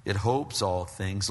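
normal talking voice

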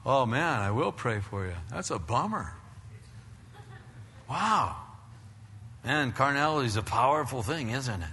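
a hint of a smug churchgoer offering to pray; mock pious sympathy. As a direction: churchgoer hiding his own struggles from a friend, lightly mock pious. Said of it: "you go instead".

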